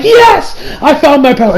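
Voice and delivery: elated acted outburst; triumphant. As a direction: exclaim it like a big win. Acted triumph on finding passport.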